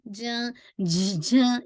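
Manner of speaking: झ with breath leaking out; wrong airy jeem. Shown as a demonstration. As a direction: demonstrate the airy mistake. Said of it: "ये sound नहीं आनी चाहिए". (ग़लत झ, हवा के साथ)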